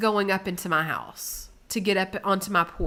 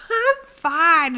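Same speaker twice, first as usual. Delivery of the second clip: giggly reply; 6 semitones higher, 3 dB louder than usual. Retold or imitated. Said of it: "she's laughing".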